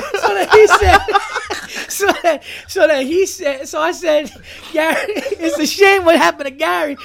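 telling it through giggles; crying with laughter. Real, not acted. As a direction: try to get the story out while laughing. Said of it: "as I'm crying about picturing Gary".

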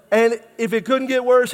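normal talking voice

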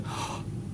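sharp breathless gasp of a caught man; mock shocked gasp. Caught father-in-law gasping at the ring. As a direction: gasp as if caught red-handed. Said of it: "the father-in-law is like".

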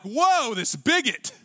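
high-pitched mock indignation; acted outrage, jumping up in pitch; offended listener. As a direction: recoil as an affronted churchgoer, then storm off. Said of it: "you're like".

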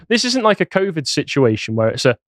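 normal talking voice

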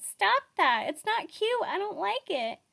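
high, loud mock-squeamish whine; faux squeamish. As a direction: squeal it like a fake-prissy guy. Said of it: "in a faux squeamish voice said".

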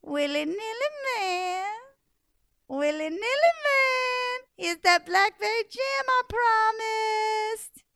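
put-on high sugary old woman, sweetly calling; old woman sweetly luring the man; sweetest voice. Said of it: "she found the sweetest voice she could find".

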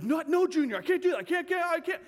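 high, loud imitation of a frightened kid; mock panicked freak-out. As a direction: act out a panicking middle schooler refusing. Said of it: "they're just freaking out".

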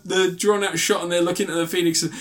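ordinary talk

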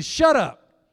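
loud, high-pitched acted "shut up"; forceful command. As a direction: order the demon to silence. Jesus ordering the demon silent.

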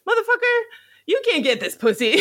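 mock Chris Rock taunting a rival; Chris Rock impression. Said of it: "he does a Chris Rock impression".

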